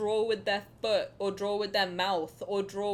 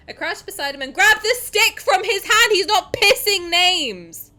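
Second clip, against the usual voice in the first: high, shouted read-aloud; indignant loud reading; 8 semitones higher, 14 dB louder than usual. Acted. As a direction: shout the passage to prove a point. Loud indignant reading to prove point.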